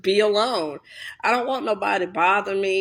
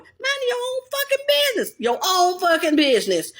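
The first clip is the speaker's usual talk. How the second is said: mind your business; 10 semitones higher than usual. Acted. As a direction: shove him away with words. Telling off starer.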